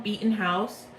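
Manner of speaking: usual speaking voice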